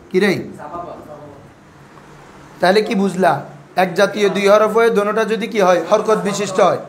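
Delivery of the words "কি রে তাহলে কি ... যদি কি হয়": usual speaking voice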